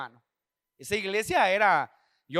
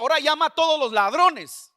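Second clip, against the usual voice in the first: retold divine order, raised and louder; firm command; 5 semitones higher, 6 dB louder than usual. The Lord instructs the preacher to call the thieves, firm command. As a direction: give the order high and forceful.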